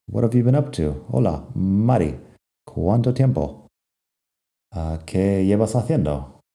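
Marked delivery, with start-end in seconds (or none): 0.1-1.1 "What have you been up to" quickly run together; fast connected speech. Shown as a demonstration. Teacher rereading the greeting to students, fast and joined up. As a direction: rattle off "What have you been up to" as one linked phrase. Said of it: "lo junto un poco y lo digo rápido".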